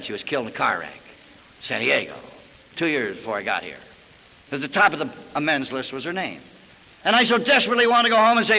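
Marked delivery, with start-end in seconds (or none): 0.0-6.4 low, soft, grieving; quiet real grief, voice dropping low; sad. Real, not acted. Recalls his mother's death.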